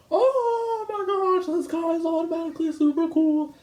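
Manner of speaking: very high, breathy-free falsetto gush; swooning fangirl. Retold or imitated. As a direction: gush like a starstruck fan, stretching the oh. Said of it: "they're just like".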